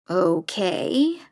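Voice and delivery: a slightly unsure, hesitant okay; agree, but hold back a little. A narrator's reading. Wary of meeting the prisoner, she agrees hesitantly. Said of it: "I say it a little more hesitantly this time".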